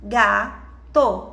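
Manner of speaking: "Gato" split as ga, to, with a gap between; syllable by syllable. Shown as a demonstration. Teacher asking how many syllables there are, split slowly. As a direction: say "Gato" in two separate, held beats. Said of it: "Quantas sílabas tem?".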